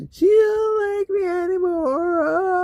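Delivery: very high, loud, drawn-out fake crying; mock whiny wail. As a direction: wail it slowly like a hammed-up whiner. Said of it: "This is the whiny, complaining".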